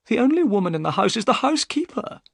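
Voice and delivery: worried host puzzled by the dark house; anxious Thaddeus. A narrator's reading. Thaddeus voiced in the story.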